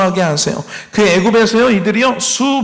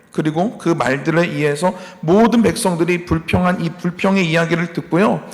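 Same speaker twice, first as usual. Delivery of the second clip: usual speaking voice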